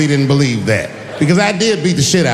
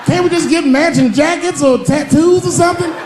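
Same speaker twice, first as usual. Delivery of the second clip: high horrified pleading; 11 semitones higher, 3 dB louder than usual. Retold or imitated.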